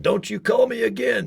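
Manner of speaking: high indignant snap; mock angry shout. Rude prospect rejecting, mock angry shout. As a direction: bite it off, offended. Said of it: "and five going".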